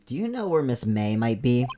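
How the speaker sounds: ordinary talk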